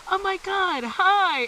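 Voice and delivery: high, bright-eyed hello; over-cheerful greeting. Retold or imitated. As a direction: greet them far too happily. Cooper greets party, arrow in knee.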